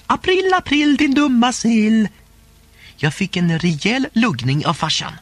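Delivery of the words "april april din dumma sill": gleeful roar. A narrator's reading. Boy yelling at his parents in the emergency room, gleeful roar. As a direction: crow it out like a prankster caught nobody. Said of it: "vrålade glatt".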